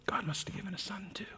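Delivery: soft, quick whispered repeat of the line; breathy hushed whisper, almost no voice; child's whisper. Shown as a demonstration. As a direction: whisper it again, hushed with awe.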